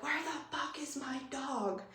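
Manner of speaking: high, hushed version of a panicked yell; mock screaming. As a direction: imitate screaming without the volume. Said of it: "just screaming and screaming".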